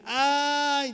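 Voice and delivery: high pained "¡Ay!" cry; complaining ouch. Shown as a demonstration. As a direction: whimper "¡Ay!" as if it hurts. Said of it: "en vez de decir".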